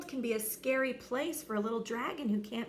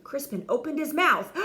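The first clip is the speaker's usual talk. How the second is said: ordinary delivery, nothing marked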